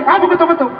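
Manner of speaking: very high-pitched indignant cry; mock yell. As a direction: yelp it at the one knocking. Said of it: "Tapi dia akan teriak".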